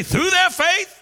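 high, rousing shout; emphatic call, pitch leaping up; like you mean it. Shown as a demonstration. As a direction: fire up the congregation to shout it back. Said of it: "Say it like you, you mean it".

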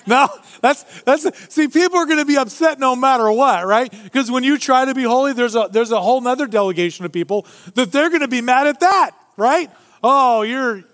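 high, loud, urgent preaching; preacher building to a high, loud peak; fervent preaching. Acted. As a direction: drive the point home to the congregation. Preaching at full force.

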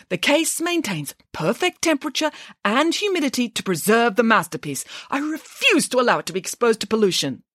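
high, pompous director voice; haughty official's protest; indignant refusal. Acted. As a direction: lecture them while refusing.

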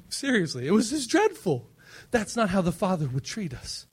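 ordinary delivery

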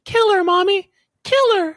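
put-on high whispery child voice; very high creepy kid voice. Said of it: "I was actually gonna give the actual voice".

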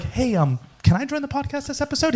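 mock-innocent voice, high and soft; imitating someone sweetly asking to join in; Bryce. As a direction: ask timidly in a high, hushed voice. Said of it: "He did it so innocently".

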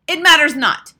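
high, very loud dismissal; commanding witch bellow; shouting witch. A narrator's reading. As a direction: shout it imperiously over the crowd. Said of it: "shouted the Grand High Witch".

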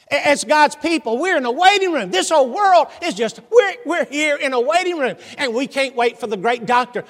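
high, loud, ringing sermon delivery; full-force preaching. Real, not acted. Preacher tells congregation of the great physician's coming, full-force preaching. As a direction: preach it out to the whole room.

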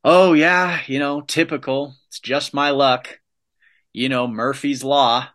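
mock someone who always expects bad luck; imitating a gloomy friend shrugging off bad luck; mock resigned complaint. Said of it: "I heard somebody the other day say".

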